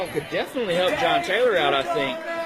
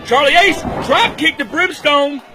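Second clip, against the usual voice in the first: loud, higher excited play-by-play; wrestling commentator; 6 semitones higher, 4 dB louder than usual. Real, not acted. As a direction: call the high spots with rising hype. Wrestling commentator calling big moves.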